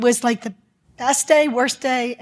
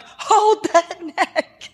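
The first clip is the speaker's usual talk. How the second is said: high, very slow, drawn-out command; retold urgent order; 7 semitones higher, much slower than usual. Doctor recalling the C two fracture, retold emphatic order. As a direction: stretch each word out, pitched high, laughing.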